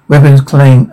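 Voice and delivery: ordinary delivery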